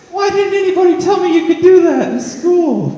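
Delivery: high excited exclamation; amazed outburst. Retold or imitated. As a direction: squeal it like a delighted discovery. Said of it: "I'm like".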